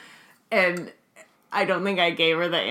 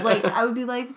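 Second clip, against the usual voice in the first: usual speaking voice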